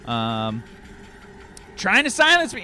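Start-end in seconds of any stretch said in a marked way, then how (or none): 1.8-2.6 indignant ranting crank. Acted. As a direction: cry out like a persecuted street preacher. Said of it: "he starts to speak up again".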